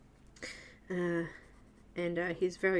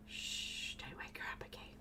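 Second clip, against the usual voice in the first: whispering over a crib; 15 dB quieter than usual. Pretend. Pretend baby sleeping, hush.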